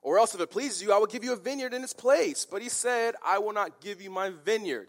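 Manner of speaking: high, petulant mock complaint; stomping tantrum. King telling his wife of the refusal, petulant tantrum. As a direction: whine it out like a foot-stamping child. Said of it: "stomping his feet there".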